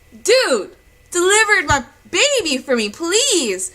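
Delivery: high, loud, pleading retort; acted-out loud, impatient begging; exasperated plea. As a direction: plead with a distracted doctor, exasperated. Retold plea to distracted doctor.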